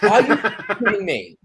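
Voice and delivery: high, laughing disbelief at a stolen idea; mock outrage. Wrestler to friend about a stolen gimmick, high laughing outrage. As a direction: squeal it out in fake outrage. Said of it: "I'm like".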